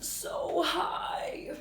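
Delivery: drawn-out airy near-whisper; breathy awe. Pretend. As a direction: stretch it out in hushed wonder. Marvelling at the tall mountain.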